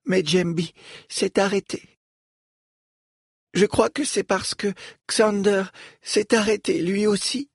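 a lightly voiced young girl. Falom speaks at length.

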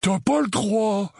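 high, drawn-out protest; alarmed Dudley. Acted. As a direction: protest at once as the wand comes out. (protestation alarmée, aiguë et traînante)

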